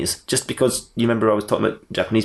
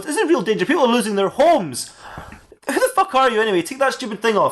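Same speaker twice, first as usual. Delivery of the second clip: angry April; 11 semitones higher than usual. Acted. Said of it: "She goes".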